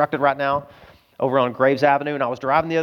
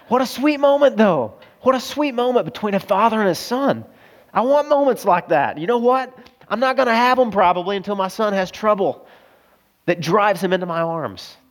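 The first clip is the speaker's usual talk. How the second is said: high-pitched, choked-up wonder; emotional; 5 semitones higher than usual. Real, not acted. Preacher moved by a father embracing his son, high emotional voice. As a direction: push through the feeling, pitch lifted. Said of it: "I'm kind of emotional right now".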